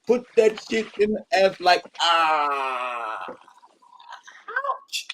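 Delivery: high mock moan to ouch. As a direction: ham up a pained moan.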